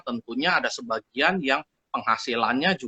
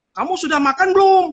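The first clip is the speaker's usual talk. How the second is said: "Kamu sudah makan belum?" yelled much higher and louder; shouted question; 9 dB louder than usual. Shown as a demonstration. Demo of asking about food but shouting it. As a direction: bark a simple question like picking a fight. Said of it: "Ah, tapi teriak".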